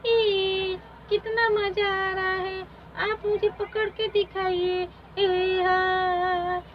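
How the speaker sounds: put-on a delighted girl begging to play; excited little girl. Poonam begs the fairy queen to play, excited. Child playing chase.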